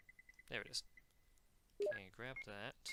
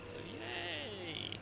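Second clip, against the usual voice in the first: very high, drawn-out yay; mock cheer. Player entering an area he hates, mock cheer. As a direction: squeal a fake cheer on a held high note.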